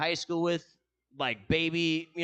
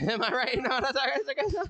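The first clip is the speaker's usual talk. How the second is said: laughing squeal; 10 semitones higher, 4 dB louder than usual. Real, not acted. Laughing, hyped banter.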